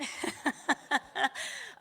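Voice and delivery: high, loud run of laughter; real laughter. Real, not acted. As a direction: burst out in rapid peals of laughter. Laughs instead of explaining.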